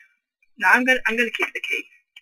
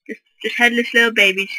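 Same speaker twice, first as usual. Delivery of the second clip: ordinary delivery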